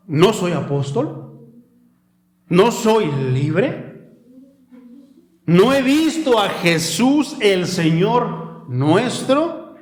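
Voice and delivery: slow, drawn-out rhetorical questions; scripture reading. Real, not acted. Preacher reading Paul's questions to his congregation, slow solemn recitation. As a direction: read each question deliberately, lifting the ending.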